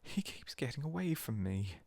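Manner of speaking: a slow, high, tearful wail; boy wailing about his lost toad. A narrator's reading. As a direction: plead over the lost toad, near tears. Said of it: "he wailed".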